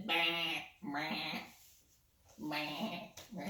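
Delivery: short playful goat bleats; bleating goat. Acted. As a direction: bleat each one separately. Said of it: "Goat says".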